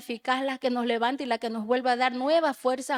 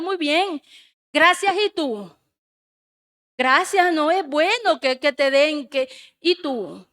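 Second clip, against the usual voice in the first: confronting; 3 semitones higher, 5 dB louder than usual. Retold or imitated. God's confronting voice, retold.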